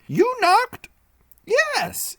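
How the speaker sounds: a tiny bent doorkeeper amused; odd little man. Acted. Small bent man at door.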